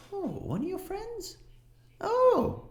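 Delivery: lightly voiced, high-pitched shop woman; a high, sing-song lady behind the counter; shopkeeper woman. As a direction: shopkeeper humoring a customer, high voice. GM voicing the shopkeeper.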